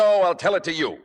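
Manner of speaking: loud, high, emphatic interruption; forceful, angry insistence. Acted. Boyfriend refusing to be sent to Doctor Freud, angry insistence.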